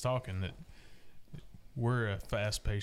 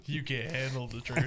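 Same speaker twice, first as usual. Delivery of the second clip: movie quote; 4 semitones higher than usual. Retold or imitated. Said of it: "Do you want the truth".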